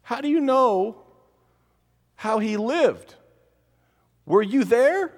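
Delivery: high, loud, incredulous challenge; challenging. Real, not acted. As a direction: demand to know if they were there. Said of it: "challenging the claim".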